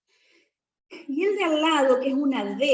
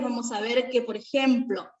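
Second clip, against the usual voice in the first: ordinary delivery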